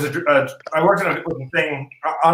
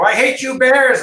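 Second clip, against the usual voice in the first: scripted shout; 8 semitones higher, 5 dB louder, slower than usual. Retold or imitated. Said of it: "Walken was supposed to go".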